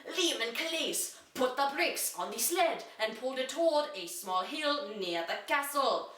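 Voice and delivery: plain reading voice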